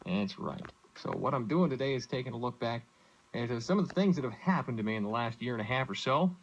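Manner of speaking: usual speaking voice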